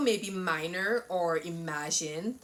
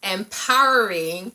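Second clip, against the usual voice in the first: loud, bright host greeting; energetic vlog intro; 10 dB louder than usual. Acted. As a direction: pump up the channel intro.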